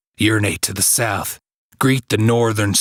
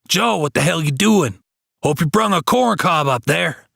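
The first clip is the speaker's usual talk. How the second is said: calling up; 8 semitones higher than usual. Acted. Hake calls to Joe on roof.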